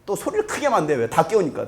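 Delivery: ordinary delivery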